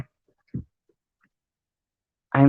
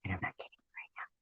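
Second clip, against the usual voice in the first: whisper; 6 semitones lower, 20 dB quieter, faster than usual. Real, not acted. Scared outside at night.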